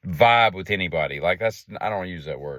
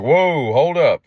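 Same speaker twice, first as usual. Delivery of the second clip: higher and louder, hammed-up surprised protest; mock scammer girl voice; 8 semitones higher, 8 dB louder, slower than usual. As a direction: play the scammer pretending to be offended. Mimicking scammer's defensive reply.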